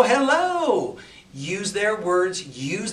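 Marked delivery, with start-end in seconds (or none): 0.0-1.0 high, loud drawled "Hello" falling at the end. Shown as a demonstration. Coach shows mirroring the client's drawl back, drawled. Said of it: "it's okay to go".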